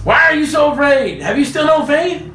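Jesus chiding frightened disciples; mock stern rebuke. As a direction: scold the disciples playfully.

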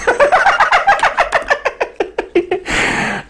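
long high falsetto laugh. Real, not acted. Preacher amazed at what happened, long high laugh.

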